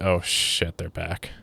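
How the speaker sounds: imitating someone's private dismay, softly; quiet retold dismay. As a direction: voice a dreading thought under your breath. Clown's imagined reaction to women returning.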